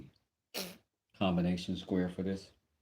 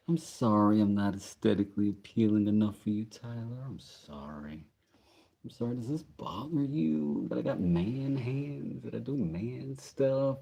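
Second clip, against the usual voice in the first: sarcastic sorry-not-sorry, pitched up; sarcastic apology; 5 semitones higher than usual. Pretend. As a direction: apologize with exaggerated fake concern.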